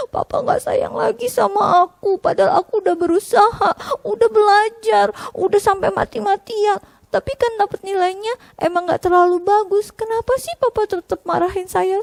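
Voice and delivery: put-on high, tearful kid voice; hurt child. Child's inner hurt complaint. (anak sedih dan kecewa)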